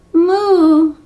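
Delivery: a loud, high, drawn moo; cow mooing. Acted. Gerda the cow protesting as giants reach, loud moo.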